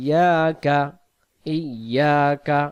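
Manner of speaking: ইয়াকা chanted slowly as "iyyaaka"; correct recitation. Shown as a demonstration. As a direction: hold the double y and long "aa" in ইয়াকা. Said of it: "এখানে হবে".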